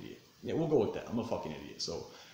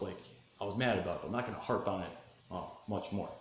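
ordinary delivery

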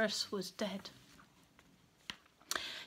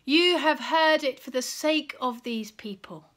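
loud, proclaimed prayer; forceful public prayer; crying out; 3 semitones higher, 7 dB louder than usual. A narrator's reading. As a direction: declare it out loud to the Father. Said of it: "Jesus cried out suddenly".

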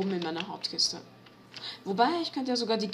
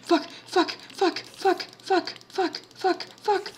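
high frantic repeated swearing; panic, spit them out fast; panicked high cursing; 10 semitones higher, 3 dB louder, slower than usual. Real, not acted.

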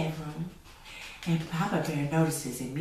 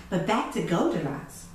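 usual speaking voice

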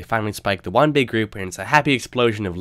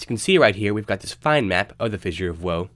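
normal talking voice

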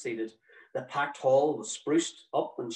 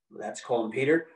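ordinary delivery

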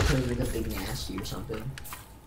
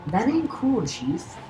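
high put-on voice; mock tough guy; 9 semitones higher, much slower than usual. Gamer mock-scolding the character in a high voice. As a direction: sass the character in a silly voice.

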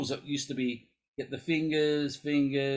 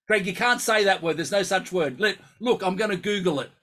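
mock outrage; 5 semitones higher, 5 dB louder than usual. Real, not acted. Wife disputes a word.

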